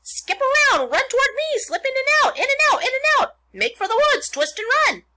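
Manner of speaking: very high, loud goose squawk; goose crying orders. A narrator's reading. The goose coaching Wilbur to escape his chasers, very high loud orders. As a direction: fire off shrieked commands as an excited goose. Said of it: "The goose took command and began to give orders".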